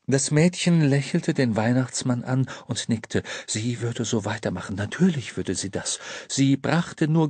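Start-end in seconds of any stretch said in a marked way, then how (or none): none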